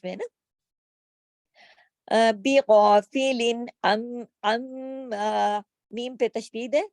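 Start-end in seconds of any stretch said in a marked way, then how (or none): 2.3-5.6 slow chant; measured chant; Quran recitation. Shown as a demonstration. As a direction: recite slowly. Teacher recites verse.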